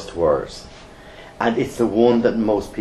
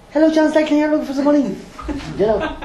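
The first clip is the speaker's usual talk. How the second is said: squeaky high-pitched phone greeting, louder; too high pitch; 3 dB louder, faster than usual. Shown as a demonstration. Caller demoing a bad high-pitched phone voice. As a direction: pitch it way up so nobody takes you seriously. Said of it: "If you speak too high pitch".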